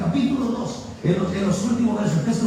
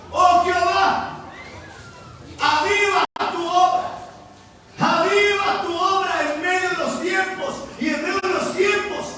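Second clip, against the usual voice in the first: declaimed prayer; 6 semitones higher than usual. Real, not acted. Preacher voices Habacuc's prayer to God, high declamation. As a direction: call the prayer out high, phrase by phrase.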